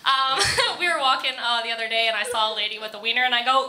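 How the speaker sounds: ordinary delivery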